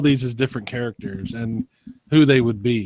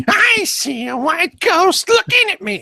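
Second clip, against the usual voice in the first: high, slow cackling witch voice; Wizard of Oz witch; slower than usual. Pretend. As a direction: screech it like the Oz witch. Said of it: "the witch from Wizard of Oz".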